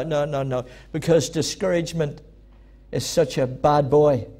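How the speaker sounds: ordinary talk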